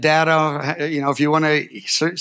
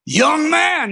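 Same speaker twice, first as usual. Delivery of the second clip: high, ringing stern call, a notch stronger; louder stern voice of the Lord; 10 semitones higher than usual. Retold or imitated. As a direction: call out the summons with more force. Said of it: "Now it's a volume six".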